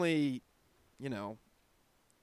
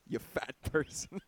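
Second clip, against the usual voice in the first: laughing; 7 dB quieter than usual. Acted. Teasing insult through laughter.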